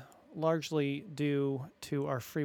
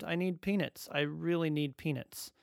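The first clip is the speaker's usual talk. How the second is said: usual speaking voice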